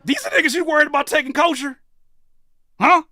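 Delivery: high, incredulous rant at the clip; incredulous high outburst. Real, not acted. Streamer scoffing at the clip, high and incredulous. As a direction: scoff at what he just heard.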